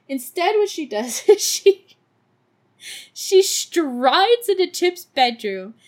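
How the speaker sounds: normal talking voice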